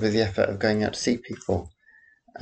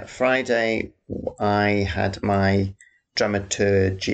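normal talking voice

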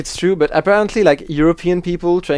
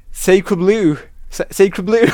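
"Sacre Bleu" as "say-cruh bloo", higher; American accent; 3 semitones higher, slower than usual. Shown as a demonstration. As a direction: overdo an American accent on the French swear. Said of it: "In American, I guess it would be".

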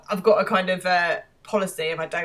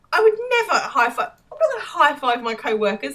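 high, loud indignant outburst; high and loud, genuinely appalled; 7 semitones higher, 5 dB louder than usual. Real, not acted. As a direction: protest in real shock. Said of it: "I'm appalled by this".

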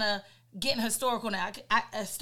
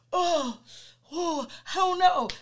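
mock dazed groan; 7 semitones higher, 5 dB louder, slower than usual. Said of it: "making dumbass noises like".

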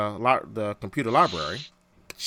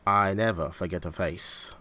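put-on low, hushed horrible Khan accent; low, menacing attempt at Khan's accent; 6 semitones lower, 4 dB quieter than usual. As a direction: do a bad Khan accent, pitched down. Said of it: "That's a horrible accent".

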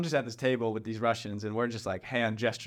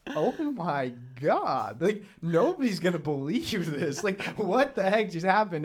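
stunned disbelief; 5 semitones higher than usual. Retold or imitated. Stunned after the crash.

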